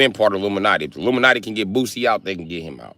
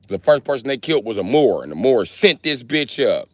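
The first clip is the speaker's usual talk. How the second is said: ordinary talk, nothing marked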